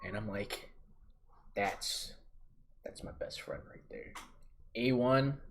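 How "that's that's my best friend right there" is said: hushed, moved aside; boy spotting his best friend, hushed; touched whisper. Retold or imitated. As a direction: whisper it like a proud confession. Sees his friend waiting.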